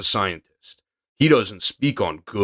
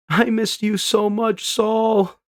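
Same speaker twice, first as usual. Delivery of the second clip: high, emotional longing voice; high emotional girl voice; 10 semitones higher, slower than usual. Acted. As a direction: say it missing him deeply. Robin's tearful reunion line.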